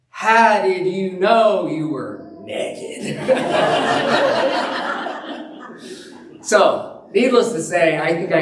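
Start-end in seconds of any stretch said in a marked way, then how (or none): 0.1-3.2 slow, booming mock voice of God, laughing through it; the reader's grand God voice, very slow, cracking up. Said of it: "this is how I imagine the voice of God". 3.2-6.0 high helpless laugh; can't hold back a laugh; laughing till tears; burst of laughter. Real, not acted. Cracking up at the memory.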